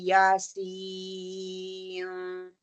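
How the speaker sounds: यासीन drawn out in a slow chant, nasal end held; slow Quranic chant; wrong held recitation. Shown as a demonstration. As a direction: chant यासीन long and merged. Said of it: "तो मैं ऐसे नहीं पढ़ूंगी".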